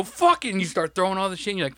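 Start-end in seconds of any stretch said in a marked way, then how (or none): 0.0-0.5 high, loud exasperated fuck it; stocker's loud fed-up snap at falling boxes; frustrated outburst. Retold or imitated. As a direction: blurt it out as the boxes all fall over. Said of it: "and you go".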